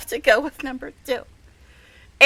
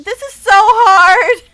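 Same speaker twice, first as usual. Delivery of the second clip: loud, slow, higher wail of torment; real lovestruck despair, louder and higher; anguished cry; 8 semitones higher, 10 dB louder, slower than usual. Real, not acted. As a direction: cry it out, overwhelmed by his pouting. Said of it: "It is so difficult".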